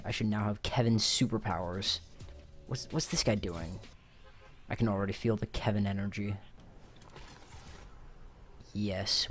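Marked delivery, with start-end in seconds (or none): none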